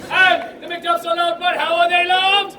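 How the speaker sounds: high, loud building shout; full-voiced rallying yell; rousing call-and-response. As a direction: whip the crowd up to a roar.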